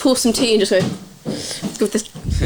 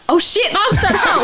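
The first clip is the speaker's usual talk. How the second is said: much higher, laughing shock; shocked realisation; 9 semitones higher than usual. Retold or imitated. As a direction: gasp as it dawns on you. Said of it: "I was like".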